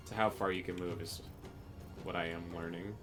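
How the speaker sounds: normal talking voice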